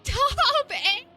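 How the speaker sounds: high, loud thrilled yelp; excited shout. Real, not acted. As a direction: shout it out in real delight.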